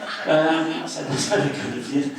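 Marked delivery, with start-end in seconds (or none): 1.1-2.1 loud, higher-pitched mock Scouse; attempted Scouse accent. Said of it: "I wish I could do a Scouse accent".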